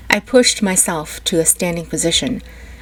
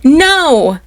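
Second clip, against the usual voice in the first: loud, higher scream of no; 8 semitones higher, 8 dB louder than usual. A narrator's reading. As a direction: scream it at the top of your lungs. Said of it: "screaming that at the top of my lungs".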